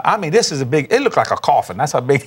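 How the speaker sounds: ordinary delivery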